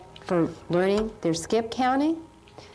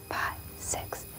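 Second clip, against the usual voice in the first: whispered count; 12 dB quieter than usual. Shown as a demonstration. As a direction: whisper the numbers softly. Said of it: "Can you whisper?".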